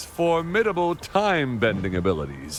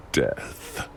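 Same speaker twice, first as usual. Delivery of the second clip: menacing whisper; 12 semitones lower, 4 dB quieter than usual. Acted. Villain savours threat of destruction.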